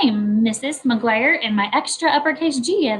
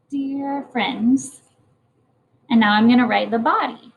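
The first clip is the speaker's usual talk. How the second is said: ordinary delivery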